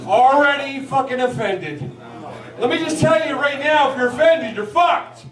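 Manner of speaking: ordinary talk, nothing marked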